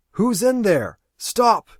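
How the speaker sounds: loud, high demand; alarmed shout. Acted. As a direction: shout at whoever is inside. Aaron yells at ship.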